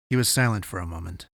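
ordinary delivery, nothing marked

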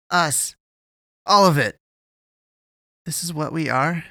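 loud, high despairing cry; anguished outburst. Acted. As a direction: let it burst out. Eddie's outburst.

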